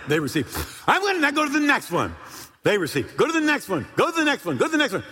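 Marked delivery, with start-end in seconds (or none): 0.9-2.1 high, raised preacher's holler; shouting. Retold or imitated. Preacher moving along the prayer line, shouting. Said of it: "literally, I am shouting". 3.2-5.0 high, repeated urgent call; shouting. Retold or imitated. Preacher hurrying along the prayer line, shouting. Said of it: "literally, I am shouting".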